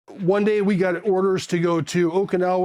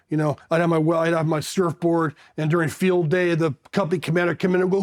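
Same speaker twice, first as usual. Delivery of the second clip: ordinary talk, nothing marked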